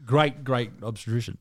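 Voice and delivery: ordinary delivery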